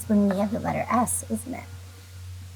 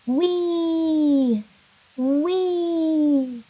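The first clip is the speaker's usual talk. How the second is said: high, sliding wee like going down a slide; 6 semitones higher than usual. Shown as a demonstration. Teacher leading kindergartners in a slide sound, high wee. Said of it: "Can you say this one with me?".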